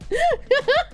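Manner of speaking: short high laugh. Real, not acted. Laughs after joking about Brazilian meat.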